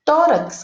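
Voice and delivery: Tórax with TÓ high and strong; stress on first syllable. Shown as a demonstration. Teacher showing pupils the tonic syllable, Tórax stressed on TÓ. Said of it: "qual é a sílaba tônica? Tó".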